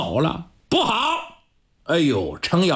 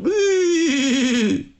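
horse halt call; 8 semitones higher than usual. Acted. Reining in the horse.

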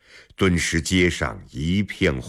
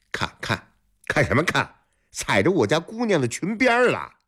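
high-pitched rude reprimand; bully's indignant telling-off, climbing in pitch; scolding lackey; 10 semitones higher, faster than usual. A narrator's reading. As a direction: berate a gawker as an officious servant. Lackey scolds the staring scholar.

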